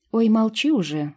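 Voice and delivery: fairy snapping at Vit; snapping back. A narrator's reading. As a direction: shut him up, irritated. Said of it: "огрызнулась сестра".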